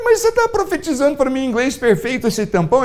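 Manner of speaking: high, amazed question; can't believe it, ask high; astonished retold question.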